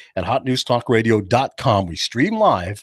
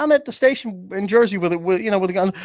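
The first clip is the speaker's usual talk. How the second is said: usual speaking voice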